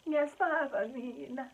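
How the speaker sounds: high, drawn-out mock sob; hammed-up tearful whine; childish crying. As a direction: whimper it slowly like a scared child. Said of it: "I was so childish about the whole thing".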